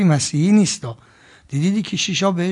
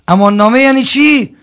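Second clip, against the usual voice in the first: loud rhetorical question; 7 semitones higher, 11 dB louder than usual. Real, not acted.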